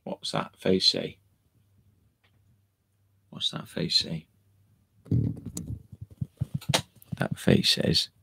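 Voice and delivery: soft unvoiced whisper. Real, not acted. Asking the dog softly what its face means, whispered.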